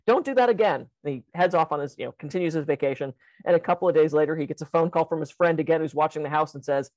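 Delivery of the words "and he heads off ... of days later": ordinary talk, nothing marked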